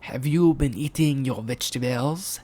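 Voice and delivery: lightly voiced cartoon question; Potato character voice. A narrator's reading. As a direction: ask it in a put-on character voice. Mister Potato questions the children.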